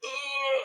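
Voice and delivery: high-pitched, drawn-out mock moan; imitated cry of pain. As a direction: mimic a hurt character crying out. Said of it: "this guy crying in pain".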